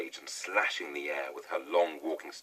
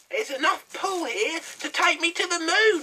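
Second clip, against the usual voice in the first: much higher, louder witch cry; crying out as hag; 13 dB louder than usual. A narrator's reading. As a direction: call it up like a gleeful old hag. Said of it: "Missus Twit cried out".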